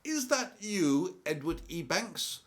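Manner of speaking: a cashier shouting at the masked robber she recognizes. Retold or imitated. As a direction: call out across the bank hall, high and astonished. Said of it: "she shouts out to him and she says".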